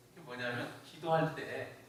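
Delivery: ordinary delivery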